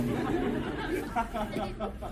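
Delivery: quiet laugh at himself; real chuckle. Real, not acted. Preacher laughs after forgetting his point, chuckling. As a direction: chuckle at losing the thread.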